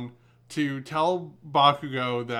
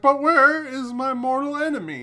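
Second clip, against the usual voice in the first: much higher, louder hammed-up heroic question; mock-dramatic hero; 11 semitones higher, 5 dB louder than usual. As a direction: ham up an anime hero's dread. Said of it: "It's like".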